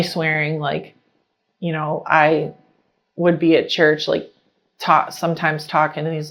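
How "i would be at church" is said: normal talking voice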